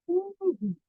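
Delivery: ordinary delivery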